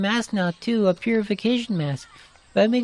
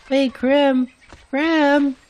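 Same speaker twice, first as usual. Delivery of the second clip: louder, higher calls after someone leaving; calling out; 5 semitones higher, 4 dB louder, much slower than usual. Acted. Calling after Krim as he runs off, loud urgent calls. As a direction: call after him across the distance.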